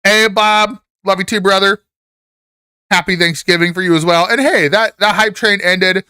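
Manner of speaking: bright upbeat shout-out; excited. Real, not acted. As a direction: greet the viewer with energy. Cheery thanks to chat.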